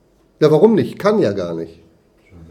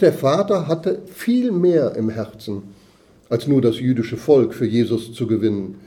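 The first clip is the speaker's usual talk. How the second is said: ordinary delivery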